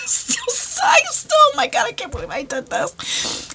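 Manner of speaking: helpless high laughter breaking through the words; cracking up, barely getting words out; laughing. Real, not acted. Telling viewers her mishap, laughing hard.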